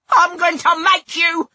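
high, loud, slow threat; threatening relish. A narrator's reading. Narrator brandishing scissors at Ralph, relishing it. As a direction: relish each word as you threaten. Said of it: "I said with relish".

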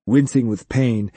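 plain reading voice

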